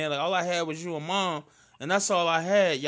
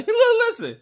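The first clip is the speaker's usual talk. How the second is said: much higher and louder mock pushy voice; imitated loud guy; 9 dB louder, much faster than usual. A stranger stops him on the street, hammed-up loud call. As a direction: ham up a loudmouth grabbing attention. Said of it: "Motherfucker say".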